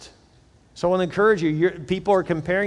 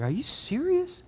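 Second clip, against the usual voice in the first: very high, drawn-out disbelief; incredulous; 12 semitones higher, 5 dB quieter, slower than usual. Retold or imitated. Father hearing of his daughter's text, retold incredulous. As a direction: squeak it out slowly in mock disbelief.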